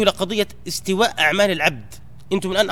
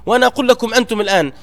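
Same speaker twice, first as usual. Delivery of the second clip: ordinary talk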